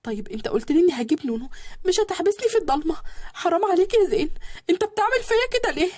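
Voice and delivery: high voice begging in the dark; frightened young wife. A narrator's reading. (زوجة خايفة بتترجى بصوت عالي)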